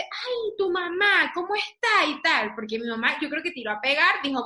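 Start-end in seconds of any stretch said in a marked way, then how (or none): 0.0-2.0 high, sweet inquiry; mock friendly question. Daughter imitates her mother asking a stranger about her mom, high and bright.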